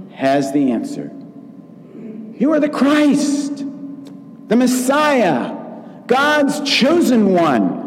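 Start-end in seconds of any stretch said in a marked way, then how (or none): 2.4-7.8 high, slow proclamation; eager declaration. Retold or imitated. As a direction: ring it out like Peter's sure answer. Peter's confession, voiced.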